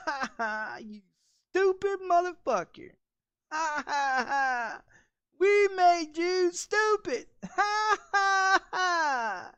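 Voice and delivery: high, slow, taunting cackle voice; mocking laugh. The government laughing at stupid Americans, high mocking cackle.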